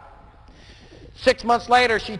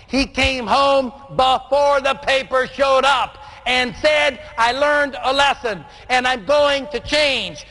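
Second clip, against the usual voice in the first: high, slowed, emphatic sermon delivery; fervent preaching; 5 semitones higher, slower than usual. Acted. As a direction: draw each line out like a revival climax. Preaching climax.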